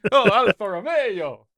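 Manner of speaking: slow, drawn-out Italian flourish; mock Italian accent. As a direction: savour it like a cliché Italian. Jokey Italian brand name.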